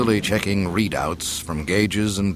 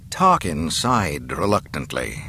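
usual speaking voice

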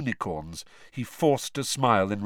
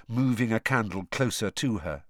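ordinary delivery, nothing marked

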